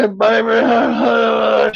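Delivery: put-on slow, high, drawn-out drunk croon; drunk slurred come-on. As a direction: croon it like a wasted boyfriend. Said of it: "and I said".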